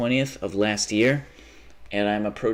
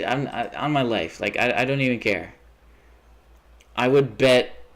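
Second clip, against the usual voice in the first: normal talking voice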